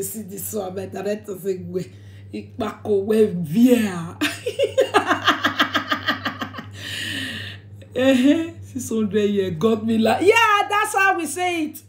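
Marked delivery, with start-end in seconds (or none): 0.0-4.2 mimicking people. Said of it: "They say". 4.2-7.6 long high airy laugh; breathy laughter. Real, not acted. After mocking the doubters, airy laughter.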